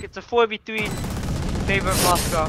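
rising excited play-by-play; esports caster. Acted. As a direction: call the retake fast and loud. Live play-by-play call.

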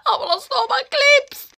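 very high wail; whiny panic. Real, not acted. Girl whines to viewers that she lost her clips. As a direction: wail it like the world is ending. Frantic getting ready.

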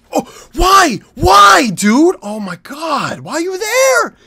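very high, loud raging shout; furious yelling. Real, not acted. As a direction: scream at the teammate in real frustration. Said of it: "I'm so mad".

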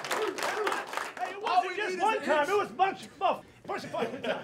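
high, loud, laughing praise; excited. Real, not acted. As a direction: rave about the defense. Coach hyped about defense.

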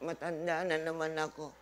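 a lightly voiced frail old mother, low and soft; mildly mock a sick mother refusing medicine; imitating old mother. Preacher mimics his sick mother refusing to be treated, lightly voiced frail old woman.

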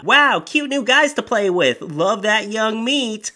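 hammed-up cougar voice; cougar mom. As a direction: purr it like a predatory mom. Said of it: "reading mom's comment".

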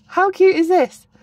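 gushing cute. Real, not acted. Admiring a pocket.

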